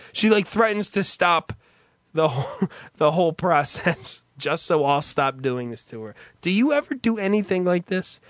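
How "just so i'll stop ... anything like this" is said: ordinary talk, nothing marked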